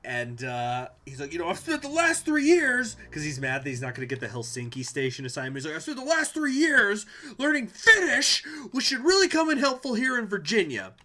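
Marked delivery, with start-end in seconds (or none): 1.3-3.0 much higher, much louder, furious rant; ranting movie agent. Retold or imitated. Gus yelling at his boss, high furious rant. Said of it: "he does that rant". 5.7-10.9 much higher, much louder, furious rant; ranting movie agent. Retold or imitated. Gus yelling at his boss, high furious rant. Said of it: "he does that rant".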